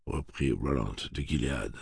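ordinary delivery

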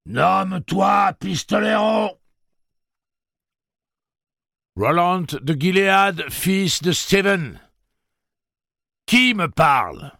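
slow, loud, high commanding call; commanding shout. Acted. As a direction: demand a name, slow and loud. The unseen voice is challenged.

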